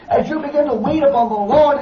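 usual speaking voice

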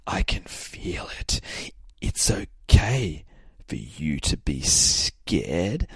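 put-on slow, eerie child-villain whisper; whispering slowly into their soul; creepy whisper. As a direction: draw it out in a spooky hush. Voices creepy possessed-looking child.

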